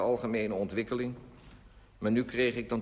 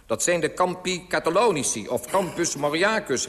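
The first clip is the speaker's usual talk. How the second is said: loud, high recital of facts; raised, emphatic voice; calling out; 6 semitones higher, 5 dB louder than usual. A narrator's reading. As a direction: shout the answer up like a quiz master. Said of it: "riep de pa van Piet".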